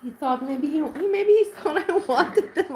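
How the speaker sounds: words pushed out through laughter; real laughter breaking in; laughing. Real, not acted. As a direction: giggle through it, up high. Laughing at the memory.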